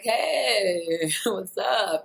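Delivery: retelling her own awkward laughing hello. Retold or imitated. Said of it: "And I'm like".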